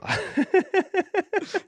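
real high-pitched laugh. Real, not acted. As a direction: burst out in a high chuckle. Laughs after calling himself dipshit.

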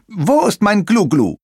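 high, loud little captain voice; high-pitched bellowed demand; demanding pirate. A narrator's reading. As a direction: demand it like a bossy small pirate. Captain demands his drink.

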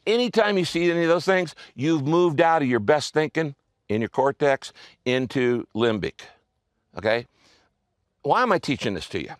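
normal talking voice

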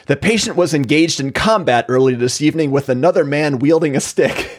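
ordinary delivery

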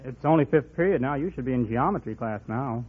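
usual speaking voice

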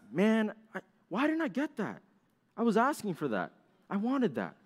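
high-pitched mock whine; whiny complaint. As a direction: act out a disappointed kid's inner grumble. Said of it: "you start complaining in your mind".

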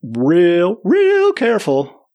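high, drawn-out "real careful"; careful emphasis. Retold or imitated. Storyteller creeping across the ice, slow careful emphasis.

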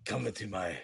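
low, soft French seducer; Pepe Le Pew. Acted. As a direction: murmur it like a cartoon French charmer. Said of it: "Pepe Le Pew".

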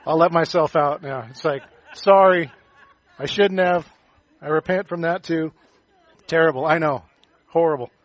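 loud, laughing mock apology; sheepish laughter. Real, not acted. Pastor apologizing to the congregation for a pun, laughing.

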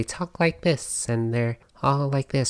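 slow, musical up-and-down lilt; sing-songy feminine voice. Shown as a demonstration. Podcaster showing how traditional feminine women talk, sing-songy. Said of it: "kind of like the sing-songy noise".

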